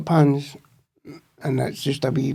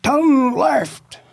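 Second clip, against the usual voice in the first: higher, barked order from a cop; mock police shout, pitched up; imitated police shout; 9 semitones higher than usual. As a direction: yell it like a cop directing a prisoner. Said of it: "And he's shouting".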